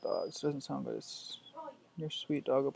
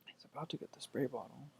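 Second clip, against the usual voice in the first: hushed whispered aside; 6 dB quieter than usual. Real, not acted. Owner whispering to viewers about the dog, hushed aside. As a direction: whisper it so the dog won't hear.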